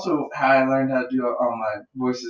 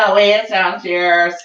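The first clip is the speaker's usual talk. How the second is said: caller voice; 5 semitones higher, 3 dB louder, slower than usual. Retold or imitated. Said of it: "Somebody's like".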